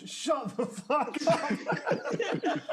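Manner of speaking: slow, high mock Myers retort, laughing; Myers snapping back. Myers mocking the chanting crowd, slow and high. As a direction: drag it out like a snarky killer. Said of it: "and Myers goes".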